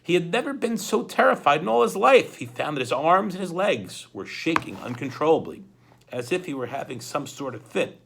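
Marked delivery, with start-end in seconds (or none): none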